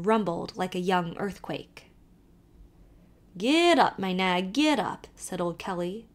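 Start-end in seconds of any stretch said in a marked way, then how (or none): 3.4-5.1 put-on lively Irish accent, calling to the horse; old Irish driver calling out; Irish carter. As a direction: urge the nag on in a brogue. Kelly drives the wagon.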